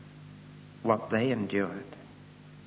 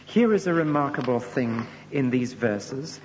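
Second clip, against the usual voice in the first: usual speaking voice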